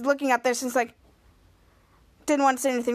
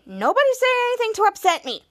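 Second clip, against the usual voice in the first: irritated warning; 8 semitones higher than usual. Retold or imitated. Retold irritated warning to others.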